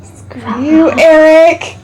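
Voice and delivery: loud playful shout at a friend; joking shout. Real, not acted. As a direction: yell it in jest. Joking outburst at teammate.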